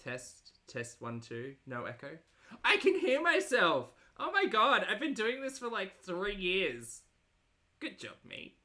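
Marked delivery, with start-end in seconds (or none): 2.6-7.0 surprised realization. Real, not acted. Hears own voice back on stream.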